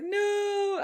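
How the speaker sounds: high, drawn-out panicked no; mock panic. Imitating Toki panicking at his recital, long high wail. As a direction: wail it upward in pretend stage fright. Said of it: "he panics immediately".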